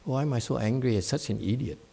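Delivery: softly moaned self-pity, mocked; mock quiet moan.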